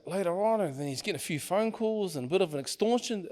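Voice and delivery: normal talking voice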